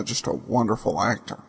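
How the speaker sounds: ordinary delivery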